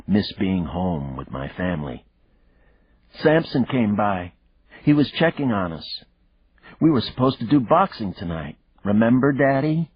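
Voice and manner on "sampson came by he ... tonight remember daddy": high, hammed-up child voice; little girl voice. A narrator's reading. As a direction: lay it on thick like a kid. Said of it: "played her part with a heavy hand".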